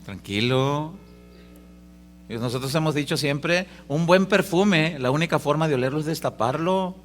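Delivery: ordinary delivery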